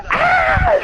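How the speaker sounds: a sudden very high, loud yelp; mock scream. He imitates her shriek as the firework booms. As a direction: screech it like a startled girl.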